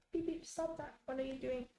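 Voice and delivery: soft, high voice for a cat; hushed, high-pitched telling-off; gentle pet scolding. Real, not acted.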